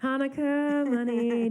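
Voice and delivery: very high, loud, stretched-out squeal of joy; hammed-up high-pitched glee; mock gleeful squeal. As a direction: squeal it slowly like a giddy winner. Mock radio-hearing celebration.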